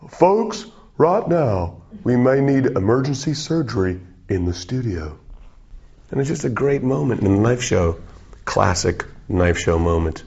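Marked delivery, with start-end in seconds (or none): 0.1-5.2 very high, urgent announcer call; urgent off-camera voice. Retold or imitated. Unseen co-host calling for surgery on air, high urgent announcer. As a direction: announce the emergency to the viewers. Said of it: "He goes".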